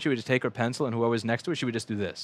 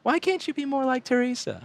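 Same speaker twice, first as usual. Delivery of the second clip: mocking teacher voice; 13 semitones higher than usual. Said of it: "the teachers would always go".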